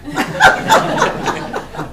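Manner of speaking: high breathy laugh bursting out; a real, loud, high laugh; burst of laughter. Real, not acted. Board members joking about name plaque.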